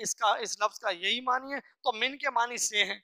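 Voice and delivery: ordinary talk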